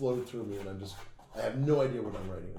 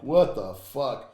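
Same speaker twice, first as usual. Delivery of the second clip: slow, louder stretched "what the fuck"; drawn-out disbelief; 2 semitones higher, 9 dB louder, much slower than usual. Retold or imitated. Retold baffled reaction.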